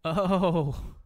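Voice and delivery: loud high oh, then a laugh; surprised laugh. Real, not acted. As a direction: caught off guard and laughing. Surprised by something on the track.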